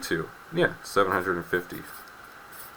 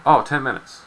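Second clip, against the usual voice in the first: irritated retort; 7 semitones higher, 8 dB louder than usual. Retold or imitated. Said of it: "I'm irritated".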